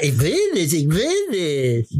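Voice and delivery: slow, mock stubborn child refusal; lightly hammed-up sulking child; childish sulk. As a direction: stamp your foot and refuse like a toddler. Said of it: "ich stapf mit dem Fuß auf und sag".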